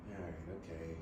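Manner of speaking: low, very soft, resigned going through the motions; methodical, deflated. Retold or imitated. Agent off to yet another settlement, methodical and deflated. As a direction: drop it low and quiet, all excitement gone. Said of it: "Then it just became methodical".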